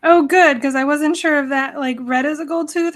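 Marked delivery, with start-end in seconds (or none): none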